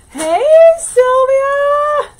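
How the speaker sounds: high, loud, slow drawn-out hey; calling out. Real, not acted. Host spots a viewer in chat and calls out loudly. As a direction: call across the room to a friend.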